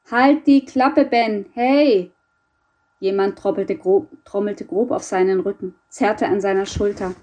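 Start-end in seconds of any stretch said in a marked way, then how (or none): 0.1-2.1 raised, loud telling-off, easing slightly; irritated shout. Acted. Friend shakes dreaming boy awake, irritated shout. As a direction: call sharply to rouse a screaming sleeper.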